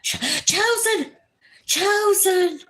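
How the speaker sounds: high, delighted calling of the name. Real, not acted. Greeting a favourite viewer, calling out delighted. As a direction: sing out the name with joy.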